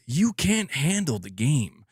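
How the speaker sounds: slow, hammed-up courtroom movie-star outburst; Jack Nicholson. Streamer parodying a film line about the game, Nicholson impression. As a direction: stretch it out like a famous film line. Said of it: "get Jack Nicholson to narrate this game".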